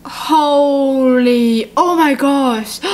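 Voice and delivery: slow, drawn-out disbelief; stunned shock. Real, not acted. Reader shocked by the story's turn, slow stunned reaction. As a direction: draw it out, stunned.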